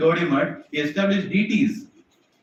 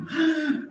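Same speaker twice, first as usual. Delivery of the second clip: delighted gasp; 12 semitones higher than usual. Acted. Krishna delighted at truth.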